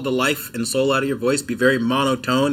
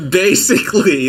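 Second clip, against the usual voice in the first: laughing; 5 semitones higher, 4 dB louder than usual. Real, not acted. Laughing after impression.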